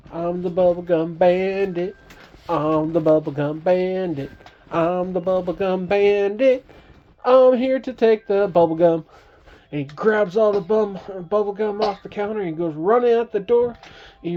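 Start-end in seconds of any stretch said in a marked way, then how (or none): 0.1-9.0 loud, chanting boast; bandit boast. Acted. Bandit pulls his gun on the shopkeep, loud proud chant. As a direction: announce yourself like an outlaw, loud.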